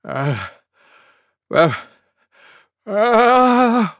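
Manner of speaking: three wind-up gasps, last one held; sneeze build-up. A narrator's reading. Elephant about to sneeze as the forest waits, building gasps. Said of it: "went Elephant".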